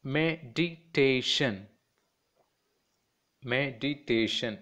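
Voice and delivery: "meditation" split as me-di-ta-tion; four distinct beats on "meditation"; four separate syllables. Shown as a demonstration. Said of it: "it's a four syllable".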